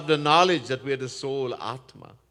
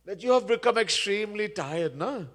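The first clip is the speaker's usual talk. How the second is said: higher-pitched mock concern; sarcastic; 6 semitones higher than usual. King to newly joined bearer, sarcastic. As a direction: needle him with false sympathy. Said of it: "He says sarcastically".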